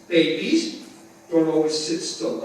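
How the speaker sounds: ordinary talk, nothing marked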